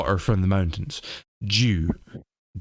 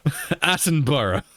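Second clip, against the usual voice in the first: usual speaking voice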